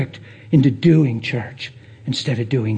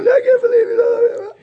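mock crying. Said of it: "seen somebody cry when they give".

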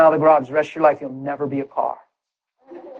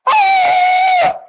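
very high loud yell; joyful shout; 3 dB louder than usual. Retold or imitated. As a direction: burst out with joy. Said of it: "I'm at work going".

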